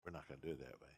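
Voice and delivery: low, hushed aside; retold whisper. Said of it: "I whispered to him".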